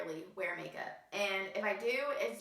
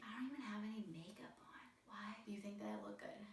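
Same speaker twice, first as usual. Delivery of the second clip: much softer, breathless whisper; retold whisper; 14 dB quieter, faster than usual. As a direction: whisper it in disbelief to yourself. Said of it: "And I'm like".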